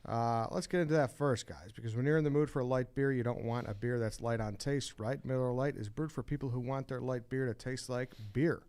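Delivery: upbeat sponsor read. Acted. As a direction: read it like a radio ad. Host reads Miller Lite ad.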